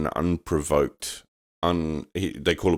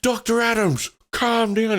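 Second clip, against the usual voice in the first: much higher mock host voice. Retelling the host scolding the wrong guest, high mocking voice. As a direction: squeak it out like a pompous host. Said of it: "He's like".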